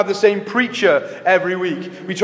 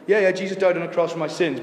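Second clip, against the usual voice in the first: quick, softer, offhand mock dismissal; flippant brush-off; 4 semitones lower, 5 dB quieter, faster than usual. Pastor mimicking a bored churchgoer, fast flippant mumble. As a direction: toss it away like old news. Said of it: "let's not get flippant about it".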